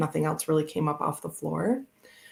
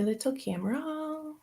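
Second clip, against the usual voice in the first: higher and softer, "Little camera" said sweetly; cutesy little voice; 9 semitones higher, 5 dB quieter than usual. Shown as a demonstration. Asked by chat to say it, a high soft cutesy coo.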